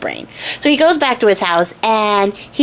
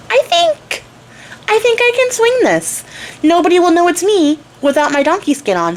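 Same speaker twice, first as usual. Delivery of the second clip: pitched way up, scheming to herself; a sly high girlish voice talking herself into it; scheming princess voice; 8 semitones higher than usual. Retold or imitated. Donkey Skin scheming to sneak into the ball, high and sly.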